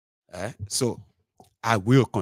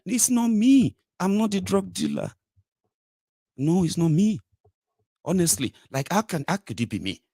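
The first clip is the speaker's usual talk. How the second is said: mock denial; 8 semitones higher than usual. Mocking president's denial.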